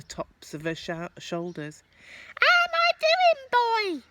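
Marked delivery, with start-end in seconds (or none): 2.4-4.0 put-on high, loud old grandma voice; cackling grandma yelling from up high; Grandma shouting. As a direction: bellow down from the roof as a gleeful old woman. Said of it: "she shouted".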